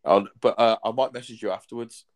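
normal talking voice

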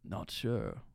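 slow, hushed narrator, sinking to a whisper; Morgan Freeman. Acted. Host narrating own doubt on request, as Morgan Freeman. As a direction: draw each word out softly like a film narrator. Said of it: "in the voice of Morgan Freeman".

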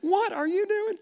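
high, startled mock cry; retold startled shriek; startled question. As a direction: squeak it out in alarm.

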